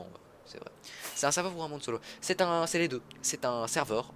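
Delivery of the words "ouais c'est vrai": whisper. Real, not acted. Mumbled admission to chat.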